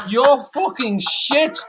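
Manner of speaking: slow, high and loud Manc contempt. Retold or imitated. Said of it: "you do such a good fucking impersonation".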